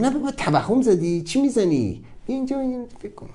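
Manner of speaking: quick, quiet dismissive jeer; mocking inner voice. The mind scoffing at his daydream, quiet and dismissive. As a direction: wave off his dream with scorn.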